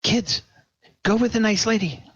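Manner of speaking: put-on mother ordering her kids, high and fast. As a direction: send the kids off urgently.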